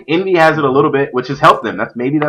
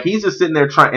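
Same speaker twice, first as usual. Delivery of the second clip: ordinary delivery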